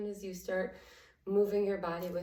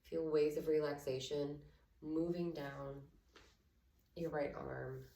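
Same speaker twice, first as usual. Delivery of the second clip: guided meditation; 3 semitones lower, 6 dB quieter than usual. Acted. Yoga teacher guiding students through a body scan, soft meditation voice. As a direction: ease them gently into stillness.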